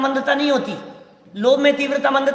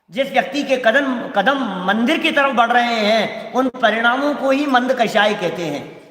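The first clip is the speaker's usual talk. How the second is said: ordinary talk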